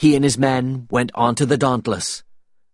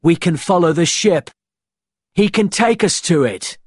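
high, raised, insistent voice; urgent, pitched-up persuasion; shouted plea; 7 semitones higher, 4 dB louder than usual. A narrator's reading. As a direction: press the argument loudly. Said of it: "shouted Will".

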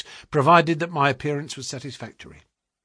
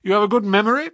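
higher, louder, quick quizzing; brisk questioning agent; 9 semitones higher, 5 dB louder, much faster than usual. A narrator's reading. Agent checks the clerk's past, brisk and prying. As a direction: fire the question like a busy agent.

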